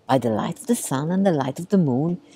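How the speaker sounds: usual speaking voice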